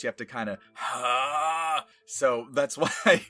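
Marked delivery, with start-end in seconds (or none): 0.7-1.8 loud raspy "ha" from the throat; raspy exhale. Shown as a demonstration. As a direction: push rasp up into the voice. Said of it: "get the rasp up in the voice".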